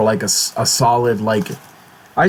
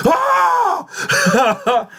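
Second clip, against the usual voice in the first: mock anguished scream. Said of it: "you're gonna be like".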